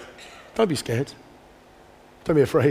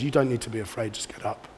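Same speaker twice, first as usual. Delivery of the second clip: soft reassurance; 5 semitones lower, 10 dB quieter than usual. Retold or imitated. Jesus reassuring, voiced softly.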